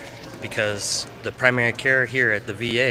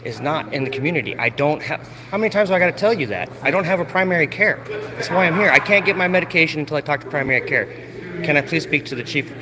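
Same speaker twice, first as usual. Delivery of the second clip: angry arguing; 3 semitones higher than usual. Real, not acted. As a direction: argue it, out of patience. Arguing with staff.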